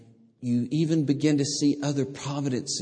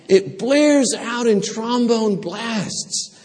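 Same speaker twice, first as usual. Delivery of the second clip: full force preaching; 12 semitones higher, 8 dB louder than usual. Acted. Preacher proclaiming God's promise to the congregation, at full force. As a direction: preach it out to the rafters.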